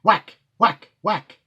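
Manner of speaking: loud, punchy chopping sounds; axe blows. Acted. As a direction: slam out each whack like an axe strike. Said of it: "struck the trunk of the tree".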